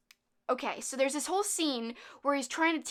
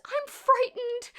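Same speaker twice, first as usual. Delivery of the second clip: mock frightened squeak; 13 semitones higher, 5 dB louder, much slower than usual. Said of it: "And she goes".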